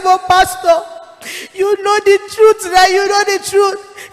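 high, loud, pleading; tearful plea. Retold or imitated. Preacher pleading with the dead pastor, high and tearful. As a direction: beg him through tears.

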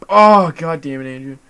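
loud, drawn-out exasperated cursing; exasperated outburst. Real, not acted. Player scolding his teammate in the game, exasperated outburst. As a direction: curse slowly and loudly in exasperation.

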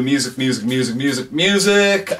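playful, escalating singsong; hammed-up repetition. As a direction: chant it, rising high and stretching the last one. Exaggerates music overload in listings.

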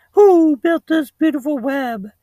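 high, hooting falsetto owl voice; talking owl, starting on a loud hoot. Acted. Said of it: "said the owl".

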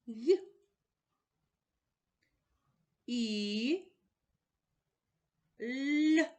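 окремі звуки «Г», «І», «Л» вище й голосніше; вимовляє кожен звук окремо; isolated sounds. Shown as a demonstration. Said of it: "послідовно назвемо звуки". (по одному звуку)

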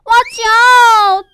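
loud, high, stretched-out shout; big drawn-out cry of caution; warning shout. Acted. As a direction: yell a warning to the little fish. Children warn the little fish.

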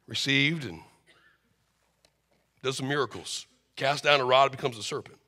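ordinary delivery

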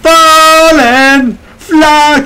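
high, loud drawn-out cry; over-the-top gamer yell; shouting. Pretend. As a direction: belt it out high and long. Shouted out during game.

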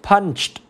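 Punched ending in a crisp t; final t sound. Shown as a demonstration. Teacher showing the ending of Punched, final t sound. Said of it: "là đọc là tờ".

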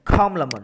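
"compliment" with COM high and strong; "compliment" dropping sharply after the first syllable; stress on first syllable. Shown as a demonstration. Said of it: "âm một".

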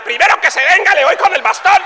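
put-on high, loud threatening old woman; feisty old lady. Blind old lady threatening the congregation, high shout. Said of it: "Dijo la viejita".

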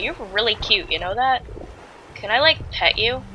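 soft, quiet sweet talk to a pet; cooing softly. Real, not acted. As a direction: coo at it like a puppy. Cooing at an animal.

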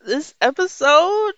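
laughing. Real, not acted. Laughing through sign-off.

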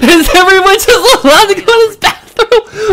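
high squeal through laughter; cracking up while talking; laughing. Real, not acted. Streamer laughing at game scene.

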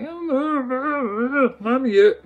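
dog voice. Retold or imitated. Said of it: "she's like".

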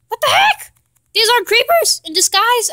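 high, sudden squeak of disbelief; startled outburst. Real, not acted. Gamer spotting fake creepers, startled. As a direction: jump up in pitch, caught off guard.

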